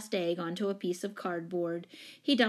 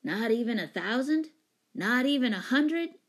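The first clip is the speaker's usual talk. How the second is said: higher, rising questions; incredulous, growing louder; disappointed disbelief; 5 semitones higher, 5 dB louder than usual. A narrator's reading. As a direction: keep asking, more incredulous each time. Don bargains down in dismay.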